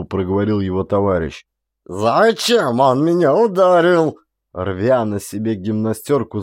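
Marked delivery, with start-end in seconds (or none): 1.8-4.2 much higher, slower, loud yell. A narrator's reading. Hurt soldier yells at the corporal. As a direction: roar it out, tearing your shirt. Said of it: "заорал он".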